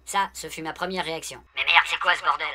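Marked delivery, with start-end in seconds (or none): 1.6-2.5 loud shocked outburst; outraged exclamation. Retold or imitated. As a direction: blurt it out in disbelief. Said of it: "Ça, c'était la deuxième".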